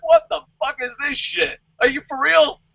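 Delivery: high, indignant mock rant; mock outrage. As a pissed-off Samoa Joe, a high mock rant. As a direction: rant like a snubbed wrestler. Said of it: "I'd be like".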